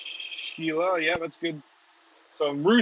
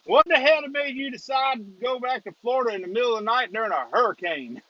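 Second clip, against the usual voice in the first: higher-pitched imitation Louisiana policeman; Louisiana accent; 7 semitones higher than usual. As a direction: put on your best incredulous Louisiana accent. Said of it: "this is in your best Louisiana accent".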